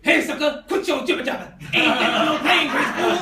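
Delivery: loud, hammed-up tough-guy bark; Mister T. Comic imagining Kingsley cast as the tough guy, loud mock bark. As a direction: bark it like the A-Team bruiser. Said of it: "have him playing Mister T".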